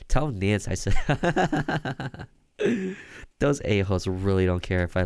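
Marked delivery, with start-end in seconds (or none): 0.1-3.0 slow, high, with a creepy laugh. Acted. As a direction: laugh through it menacingly.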